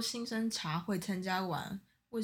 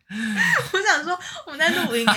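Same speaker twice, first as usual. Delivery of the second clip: laughing; 10 semitones higher, 14 dB louder than usual. Real, not acted. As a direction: stretch the words while giggling. Laughing about scare.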